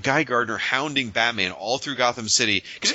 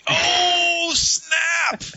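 gleeful shout. Real, not acted. Cohost gives him the win, real gleeful shout. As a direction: crow over the surprise win. Cohost concedes his pick is funnier.

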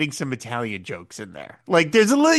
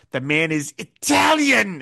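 spat out; 5 semitones higher, 3 dB louder, slower than usual. Retold or imitated. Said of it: "he spits it out".

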